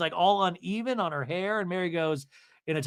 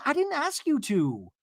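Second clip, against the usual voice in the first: mock defiance; 10 semitones higher than usual. Acted quarrel, Mary snapping back.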